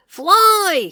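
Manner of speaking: high loud shout. Acted. Bear warning birds, loud shout. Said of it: "he shouted to the birds".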